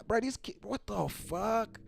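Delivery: normal talking voice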